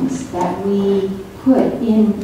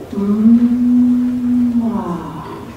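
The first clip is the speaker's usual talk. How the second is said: drawn-out M into ah; 5 semitones higher than usual. Shown as a demonstration. Author shows the sound she started with, drawn-out hum to ah. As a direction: hold the M, then release into ah. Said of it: "start with the sound M".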